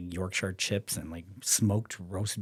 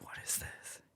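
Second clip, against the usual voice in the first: hushed, suspicious whisper; wary whisper; 15 dB quieter than usual. Retold or imitated. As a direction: whisper it as if someone might hear. Suspicious of the producer's message.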